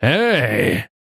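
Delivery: drawn-out roar at ordinary volume; a restrained bellow on one long word; mild roar. A narrator's reading. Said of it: "взревел Стокер". (сдержанный протяжный рёв)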